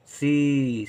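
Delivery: सी with a long held ee; drawn-out vowel. Shown as a demonstration. Teacher sounding out the last syllable, drawn-out vowel. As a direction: hold the vowel in सी. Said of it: "ई का उच्चारण देर तक".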